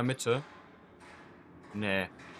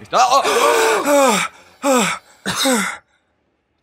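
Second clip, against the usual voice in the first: much higher, much louder startled shriek; frightened scream; 14 semitones higher, 14 dB louder, much slower than usual. Real, not acted. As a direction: scream as it jumps out. Sudden monster appears.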